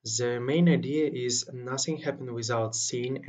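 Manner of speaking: ordinary delivery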